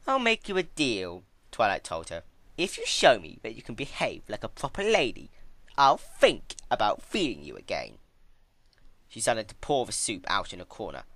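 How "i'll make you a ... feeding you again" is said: higher, louder, condescending deal; Twilight bargaining. Acted. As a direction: talk down to her like a governess.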